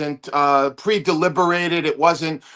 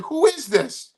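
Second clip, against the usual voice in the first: higher-pitched retold bafflement; mild puzzled question; 8 semitones higher, 4 dB quieter, slower than usual. Comic asks unknown caller once more, mild bafflement. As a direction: ask it high, imitating confusion. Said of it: "once again, I said".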